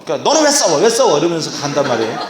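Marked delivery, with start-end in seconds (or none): 0.3-1.2 high, hammed-up telling-off; scolding parent. Parent demanding why the child fights, high mock scolding. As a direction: scold in a sharply raised pitch. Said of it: "그래도 한참 혼내고 나면".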